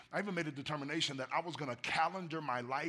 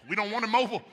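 emphatic; 7 semitones higher, 11 dB louder, much faster than usual. Retold or imitated. Pastor recalling toddler-proofing plan.

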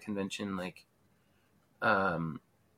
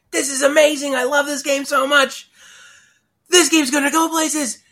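high, loud burst of excitement; imitating a thrilled fan at full volume; excited exclamation; 9 dB louder than usual. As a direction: blurt it out loud and thrilled, pitched way up. Quoting an excited playtester.

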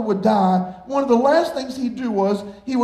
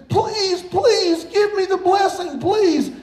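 mock pleading cry; 8 semitones higher, slower than usual. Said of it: "Esau starts screaming".

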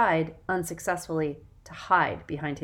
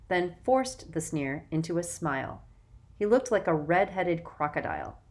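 usual speaking voice

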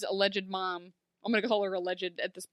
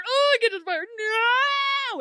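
hammed-up wail, much higher and louder; mock wail; 13 semitones higher, 7 dB louder, much faster than usual. Imitating David unable to reach the hat, louder mock wail. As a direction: overdo the helpless whine, growing.

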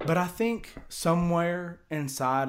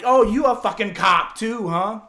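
loud, higher sarcastic jab; mocking jab; 6 semitones higher, 7 dB louder than usual. Teller mocking the fake cop, loud and sarcastic. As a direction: needle him hard and loud.

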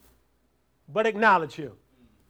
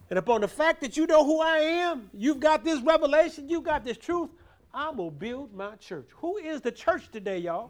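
raised, louder preacher's drive; preaching at full force; 7 semitones higher, 5 dB louder than usual. Acted. Preacher drives the point home to the church, loud. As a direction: push it out to the congregation.

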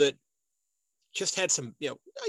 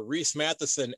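ordinary delivery

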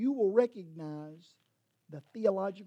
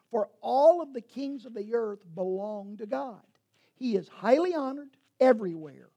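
scripture reading; 4 semitones higher, 7 dB louder than usual. Acted. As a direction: read the psalm as proclamation. Finishes reading the psalm.

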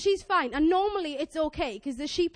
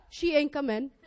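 ordinary delivery

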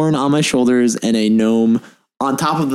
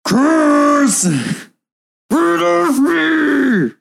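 strained character outburst; 14 semitones higher, much slower than usual. Acted. Character cursing, can't breathe.

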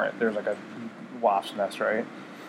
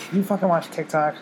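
shocked disbelief; 6 semitones higher than usual. Real, not acted. Friend admits watching TikTok.

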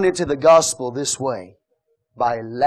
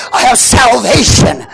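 loud, higher, half-sung sermon; full-force preaching; 8 semitones higher, 6 dB louder than usual. Acted. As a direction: chant the proclamation to the church.

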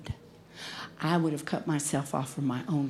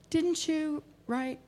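higher, slow, soft retold question; tentative question; 9 semitones higher, 5 dB quieter, slower than usual. Someone carefully asking the writer about her stolen work, tentative. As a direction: ask it carefully, high and drawn out.

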